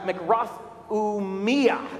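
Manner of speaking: makrothumia said slowly, stress on the me; Greek pronunciation. Shown as a demonstration. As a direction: sound out makrothumia slowly with weight on me. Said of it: "You gotta put the emphasis on the me".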